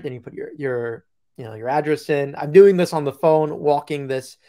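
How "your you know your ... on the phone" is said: ordinary talk, nothing marked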